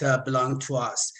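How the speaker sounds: normal talking voice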